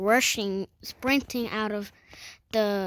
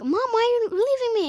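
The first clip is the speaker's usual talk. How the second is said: higher, louder, rushed plea to mom; quick, raised acted plea of alarm; panicked child; 12 semitones higher, 7 dB louder, faster than usual. Child to mom who started screaming, panicked plea.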